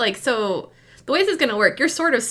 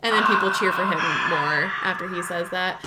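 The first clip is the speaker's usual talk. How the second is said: ordinary talk, nothing marked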